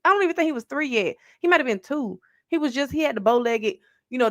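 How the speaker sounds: ordinary delivery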